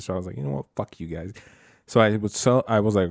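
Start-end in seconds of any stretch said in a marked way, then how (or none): 0.4-1.3 frustrated snap. Retold or imitated. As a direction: mutter it off, done with them. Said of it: "I got frustrated".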